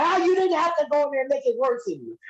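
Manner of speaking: high put-on whiny complaint; imitating the boss. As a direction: mimic the annoyed promoter. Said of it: "he's like".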